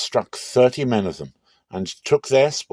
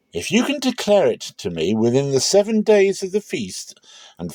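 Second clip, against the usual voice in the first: higher, bright-eyed dare to the guests; eager challenge; 7 semitones higher, 4 dB louder than usual. Acted. Samson challenging thirty feast companions with a riddle, eager and pitched up. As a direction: toss the riddle out like a wager, pitched up.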